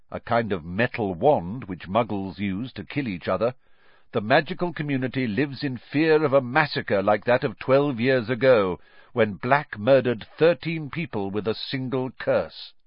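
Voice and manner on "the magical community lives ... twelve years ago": ordinary delivery, nothing marked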